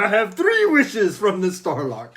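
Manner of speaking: shrill, very loud, cackling genie return; gleeful genie. Pretend. Host mocks Eddie returning as genie, shouting with laughter. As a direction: burst out of the lock laughing like a manic genie. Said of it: "like a genie".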